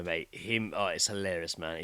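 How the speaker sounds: ordinary talk, nothing marked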